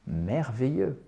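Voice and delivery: usual speaking voice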